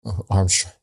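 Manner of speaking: usual speaking voice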